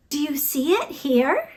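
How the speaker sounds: plain reading voice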